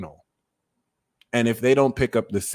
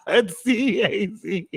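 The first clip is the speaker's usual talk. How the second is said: laughing; 10 semitones higher, slower than usual. Real, not acted. Laughing while reading the chat.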